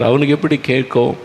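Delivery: normal talking voice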